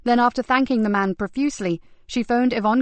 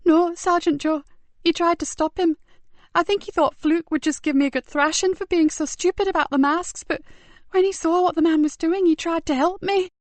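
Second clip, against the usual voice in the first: put-on tearful boy; 8 semitones higher than usual. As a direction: hold back tears while explaining. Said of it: "The tears welled up again".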